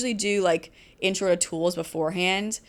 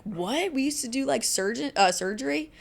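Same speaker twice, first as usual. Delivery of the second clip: mock amazement; 6 semitones higher than usual. Students reacting to barbering history videos, mock amazed.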